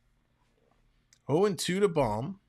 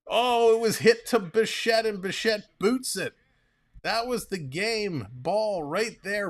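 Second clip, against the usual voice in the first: loud, high burst as the ball is booted; excited call; 8 semitones higher, 5 dB louder than usual. Real, not acted. Error on a key play.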